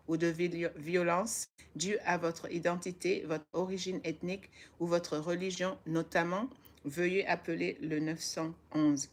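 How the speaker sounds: careful French reading of the flyer; reading a New York flyer aloud in French, careful. Shown as a demonstration. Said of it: "listen to my French".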